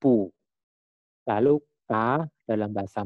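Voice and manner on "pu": "pu" with a p sound, falling; BU read as pu. Shown as a demonstration. Teacher shows how B U is read, firm "pu". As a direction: say a short firm "pu". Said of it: "Jadi B U dibacanya".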